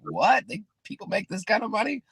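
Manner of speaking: high, amazed gasp of disbelief; jaw-on-the-floor surprise; stunned disbelief. Retold or imitated. Retold shock at the big paycheck.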